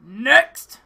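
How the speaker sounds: loud, gruff shout; one curt, booming summons; gruff guard's call. Acted. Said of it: "gruffly says".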